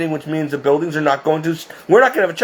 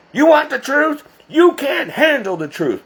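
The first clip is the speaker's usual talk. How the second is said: movie yell; 9 semitones higher than usual. Retold or imitated. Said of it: "yells and he says to Tom Cruise".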